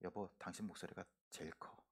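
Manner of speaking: very soft, low imitation of a wife; quiet remark. Wife privately chiding her shouting husband, quiet imitation. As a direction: drop right down to a hushed, private voice. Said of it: "제 아내가 조용히 저한테 와서 얘기합니다".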